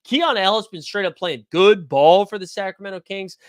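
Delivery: ordinary delivery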